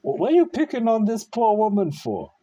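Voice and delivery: high, loud indignant demand at the screen; indignant outburst. Real, not acted. Shouting at the clip to defend the worker, high and loud. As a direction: snap at the video for picking on her.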